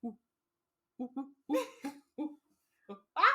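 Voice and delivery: spaced-out monkey "oohs" ending on "ah"; mock gorilla noises; imitated monkey hoots. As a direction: hoot like a gorilla, one ooh at a time. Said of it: "sorry about the monkey noises".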